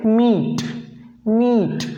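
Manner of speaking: मीट with a short ee; English word meat. Shown as a demonstration. Teacher modelling मीट for students, short ee. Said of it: "छोटा उच्चारण रखना है".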